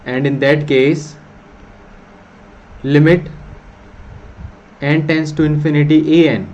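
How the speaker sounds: slow, deliberate dictation of a theorem. Acted. Teacher dictates the theorem to students, slow dictation. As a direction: spell it out word by word for notes.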